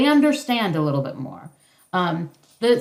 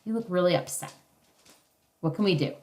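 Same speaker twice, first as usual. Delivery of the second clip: soft, low, sincere comfort; genuine; 3 semitones lower, 6 dB quieter, faster than usual. Shown as a demonstration. Parent sincerely comforting a sad child. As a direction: gently tell an upset child you see them. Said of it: "You have to be genuine".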